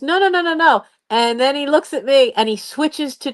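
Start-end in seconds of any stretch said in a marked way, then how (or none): 0.0-0.8 put-on boy's quick refusal. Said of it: "And he goes".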